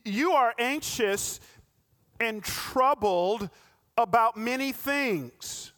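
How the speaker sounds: slow, measured lines to an anxious Martha; slow each phrase down to calm her; slow gentle rebuke. Retold or imitated.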